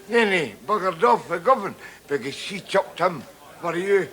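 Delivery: ordinary talk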